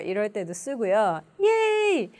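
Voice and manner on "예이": high-pitched demo cheer; celebrating. Shown as a demonstration. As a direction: cheer as if a boring job is over. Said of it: "지루한 일을 내가 끝냈을 때". (일을 끝내고 신난 환호)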